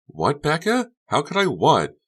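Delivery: put-on high, loud baffled girl voice; Honey puzzled. Honey asks what Becca means, high and baffled.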